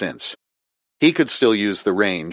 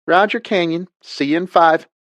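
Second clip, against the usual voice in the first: higher, louder southern pilot voice; southern woman's accent; 10 semitones higher, 4 dB louder than usual. A narrator's reading. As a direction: answer like a Southern pilot on the radio. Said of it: "female voice with a southern accent".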